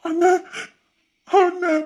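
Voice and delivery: high-pitched, breathless call to a brother; distraught cry. Acted. Younger one seeing the brother hurt, distraught high cry. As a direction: cry out in shock, voice leaping up.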